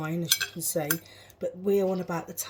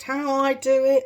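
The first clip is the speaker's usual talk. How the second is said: louder and higher; emphatic; 6 semitones higher, 7 dB louder than usual. Real, not acted. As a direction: say it proudly, with punch. Repeats the phrase louder.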